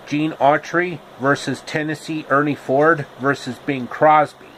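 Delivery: usual speaking voice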